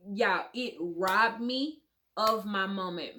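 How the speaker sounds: ordinary delivery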